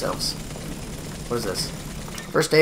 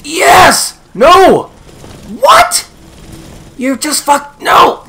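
high, loud outbursts; frantic yelling; 9 semitones higher, 8 dB louder, much slower than usual. Real, not acted. Gamer reacting as the boss fight swings, frantic yelling. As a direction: yell each reaction in disbelief and excitement.

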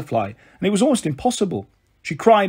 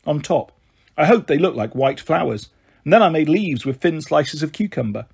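ordinary delivery

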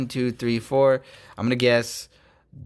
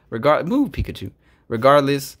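ordinary delivery

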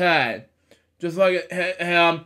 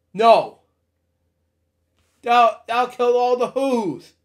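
mildly alarmed, higher-pitched plea; a touch of panic as the elephant objects; alarmed protest; 6 semitones higher than usual. Acted. Elephant protests to save the Whos.

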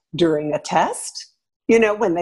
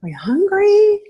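sweet high voice offering comfort; gentle caring questions; 11 semitones higher than usual. Shown as a demonstration. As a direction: ask like a kind parent checking in. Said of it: "Then you might be able to say".

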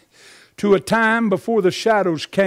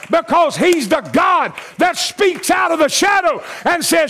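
high, building gospel climax; full-force preaching; 8 semitones higher than usual. Real, not acted. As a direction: preach it in rising waves to a roused church.

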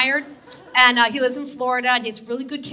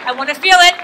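hyping the crowd; 4 semitones higher, 8 dB louder, faster than usual. Real, not acted. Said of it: "Come on".

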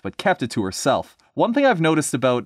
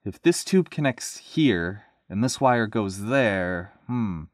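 slow, unsure puzzling; hesitant; slower than usual. Acted. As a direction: feel your way slowly through the guess. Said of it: "he stammered".

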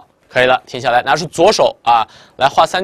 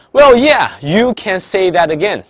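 slowed down, every word spaced out; slow careful English line; 5 semitones higher, 4 dB louder, slower than usual. Shown as a demonstration. Teacher slowly reading student B's reply to the class. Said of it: "能听清我在说什么".

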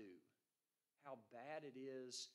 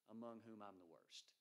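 softer, lower, humbled; preacher quoting Paul, softer and lower; quiet confession; 4 semitones lower, 5 dB quieter than usual. Retold or imitated. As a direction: say it low and hushed, ashamed. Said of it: "This is what he says".

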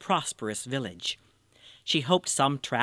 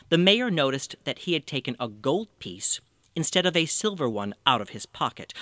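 usual speaking voice

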